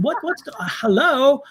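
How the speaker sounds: high, sputtering mock disbelief; mock indignant. Medium protesting to spirits who gave no warning, mock indignant. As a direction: call out the spirits, comically baffled.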